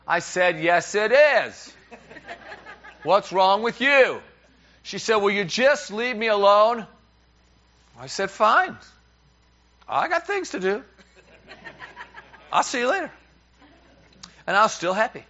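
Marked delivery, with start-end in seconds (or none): 0.6-4.2 loud, slow, raised-pitch demand; mock-exasperated marital badgering; acted quarrel insistence. 5.2-6.9 raised, louder plea to be left be; mock exasperation, high and loud; imitated exasperated wife. Retold wife snapping in quarrel. 8.3-13.1 high-pitched retort on the way out; mock-huffy exit, pitched up; acted breezy walk-off. Retold husband leaving the quarrel.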